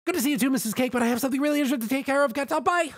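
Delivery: very high, very fast rush; spat out. A narrator's reading. Pinkie rushes past Missus Cake, very fast and high. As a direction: blurt it all and run. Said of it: "She spat out".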